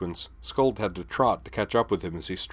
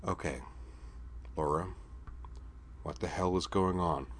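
said softly; 9 dB quieter than usual. Acted. Griffin to Laura after her outburst, softly. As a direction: gently, ask what's wrong. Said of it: "then said softly".

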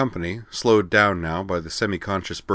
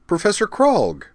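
much higher, bright delighted call; delighted exclamation; 14 semitones higher, 5 dB louder than usual. Acted. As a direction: greet a rescuer with joy. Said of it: "Utena exclaimed, delighted".

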